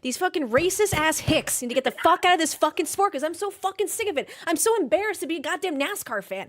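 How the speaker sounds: loud, fed-up furious rant; real anger at fans who mock accents; angry rant. Real, not acted. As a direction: spit it out, truly disgusted with the fans. Furious rant about racist fans.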